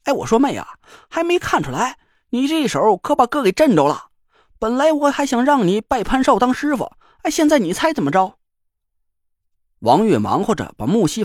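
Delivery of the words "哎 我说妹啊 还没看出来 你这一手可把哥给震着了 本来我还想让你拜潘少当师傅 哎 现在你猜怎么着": high, rapid chatterbox; a cheeky big-brother teaser; chatty joker voice. Acted. Guo Yongzhe voiced by narrator.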